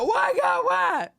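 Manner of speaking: high, loud wail to heaven; evicted mother wailing to God in the rain; despairing cry. Retold or imitated.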